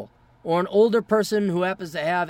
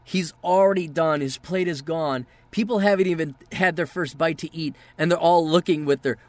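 usual speaking voice

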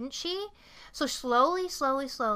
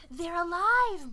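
higher, slowly drawn-out proclamation; mock exultation; 6 semitones higher, slower than usual. Killer telling Molly the mannequins live, mock exultant. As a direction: proclaim it like a gleeful madman.

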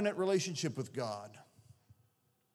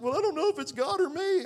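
high, loud mock wail; exaggerated weepy girl voice; retold tearful girl; 13 semitones higher, 5 dB louder than usual. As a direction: imitate an upset little girl, voice pitched way up. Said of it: "she was crying".

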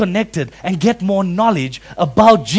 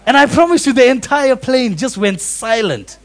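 normal talking voice

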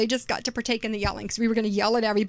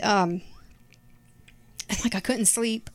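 ordinary talk

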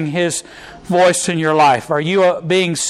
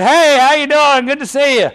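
cheery greeting; 8 semitones higher, 4 dB louder than usual. Retold or imitated. Greeting back a passing driver.

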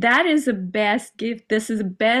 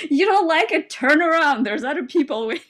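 sassy dismissal; 7 semitones higher, 3 dB louder, faster than usual. Pretend. Defiant imagined address, laughing.

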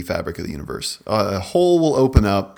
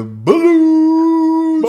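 very high, slow, loud sung name; high drawn-out wail; 10 dB louder than usual. Acted. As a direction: stretch it out high like a siren. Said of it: "live performance of the sound clip".

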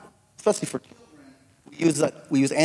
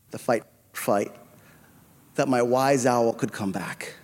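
ordinary delivery

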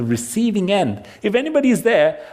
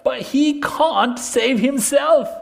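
higher, slow mock of the crucified; mocking declamation; 7 semitones higher, slower than usual. A narrator's reading. Priests jeer among themselves at Jesus, mocking. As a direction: draw out the jeer in a raised pitch. Reading aloud the mockers at the cross.

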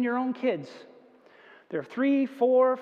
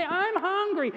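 put-on child voice; 9 semitones higher, slower than usual. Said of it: "They just say".

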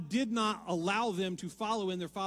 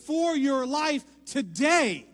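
much louder and higher, climbing; full-force preaching; 9 semitones higher, 11 dB louder than usual. Acted. Preacher urging the congregation to answer God's call. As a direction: preach with fire to the finish.

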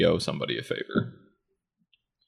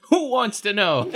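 mock tough; 13 semitones higher, slower than usual. Teasing echo of a tough line.

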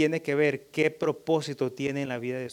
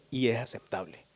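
ordinary delivery

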